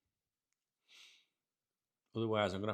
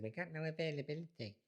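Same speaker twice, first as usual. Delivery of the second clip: higher, stiff receptionist voice; mock receptionist; 6 semitones higher, 4 dB quieter than usual. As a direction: play the unhelpful hotel clerk. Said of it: "and they said".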